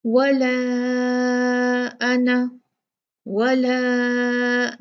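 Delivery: very slow chanted tajweed recitation; Quranic recitation. Shown as a demonstration. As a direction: recite it, drawing out the madd. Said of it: "यहाँ भी देखें". (धीमी तजवीद वाली तिलावत)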